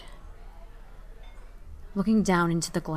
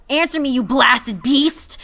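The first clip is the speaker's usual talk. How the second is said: slow, loud, higher furious roar; 6 semitones higher, 9 dB louder, slower than usual. A narrator's reading. To the wooden raven, a slow furious roar. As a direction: roar at the beast in rage. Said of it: "I roared at the monster".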